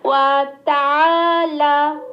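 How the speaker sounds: slow recitation. Shown as a demonstration. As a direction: draw out every vowel. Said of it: "ধীরে ধীরে পাঠ করব".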